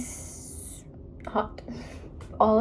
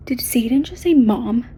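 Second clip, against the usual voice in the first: loud disbelieving gasp of a question; shocked surprise; 7 dB louder than usual. Real, not acted. Reader reacting to the audiobook, loud stunned surprise. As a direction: she can't believe what she just heard.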